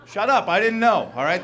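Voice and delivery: ordinary delivery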